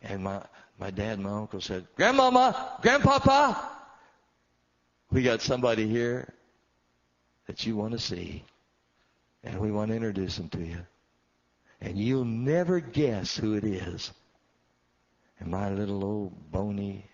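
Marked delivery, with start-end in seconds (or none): 2.0-3.7 high, loud call. Retold or imitated. Sons calling their old parents, loud and high. 5.1-14.1 louder, building preacher's tease; preaching. Acted. Preacher teasing the congregation, building and louder.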